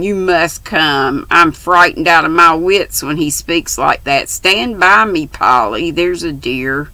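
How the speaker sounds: ordinary delivery, nothing marked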